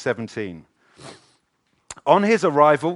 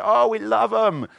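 mock gush; 10 semitones higher, 6 dB louder than usual. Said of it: "we say".

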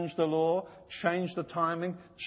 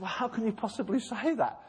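higher, laughing mock disbelief; mock incredulous bishop; 6 semitones higher than usual. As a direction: scoff like the bishop, voice up. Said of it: "this guy said".

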